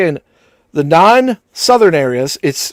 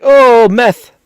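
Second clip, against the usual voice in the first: loud, higher mock Washington accent; 6 semitones higher, 5 dB louder than usual. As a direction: caricature a Washington talker, loudly. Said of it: "So they're just like".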